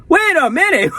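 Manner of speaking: high, laughing wait a minute; sudden laughing shock; surprised realization. Retold or imitated. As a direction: catch it mid laugh. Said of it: "It's like".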